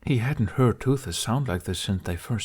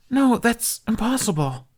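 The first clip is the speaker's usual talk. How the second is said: frightened denial; 4 dB louder than usual. Acted. Dragon attack, Jamie denies.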